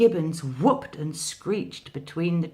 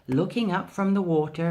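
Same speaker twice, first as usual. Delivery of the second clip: ordinary delivery, nothing marked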